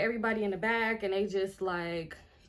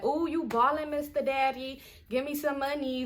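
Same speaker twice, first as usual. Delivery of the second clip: higher-pitched mock flattery; mock fawning; 5 semitones higher than usual. Mocking a girl sweet-talking a sugar daddy, fake gushing. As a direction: play a hammed-up sweet-talker begging cash. Mock gold-digger line to his dad.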